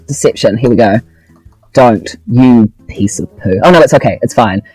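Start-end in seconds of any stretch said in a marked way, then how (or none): none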